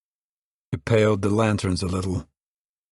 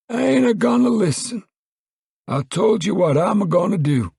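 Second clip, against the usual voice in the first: put-on high, loud, furious old man; Grandpa cried out; 13 semitones higher, 4 dB louder than usual. Grandpa furiously yells at Pa he won't leave, high and loud. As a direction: shout it high like a stubborn old coot.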